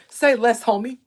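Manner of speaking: put-on loud, tense Compton accent; serious, tight Compton accent, punched out. Streamer imitating serious-looking young men, tense Compton accent. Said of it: "And real tense".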